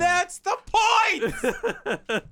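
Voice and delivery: high loud drawn-out yell; shout. Real, not acted. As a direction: yell it in protest. Shouted protest.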